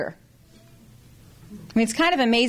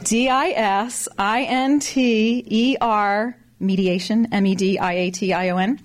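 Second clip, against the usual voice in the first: spelling it out. Shown as a demonstration. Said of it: "you've got to write it down".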